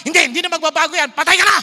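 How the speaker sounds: loud, fast condemning outburst; preacher mocking a condemning God, loud; mock harsh God. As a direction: bark out a merciless verdict. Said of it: "Hindi siya yung parang".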